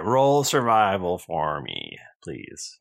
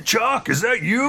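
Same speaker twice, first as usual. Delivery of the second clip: surprised friendly greeting; 12 semitones higher than usual. Acted. Barry greets Chuck.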